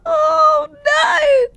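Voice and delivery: high, squealing protest; very high, squirming in horrified disbelief; squeamish horror. Real, not acted. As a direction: recoil with a half-laughing squeal. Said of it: "that makes me feel really funny".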